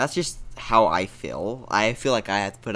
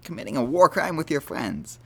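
ordinary talk

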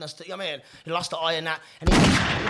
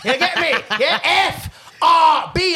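loud, high hyped-up shouting; big hype-man intro yell; 8 semitones higher, 7 dB louder, much slower than usual. Pretend.

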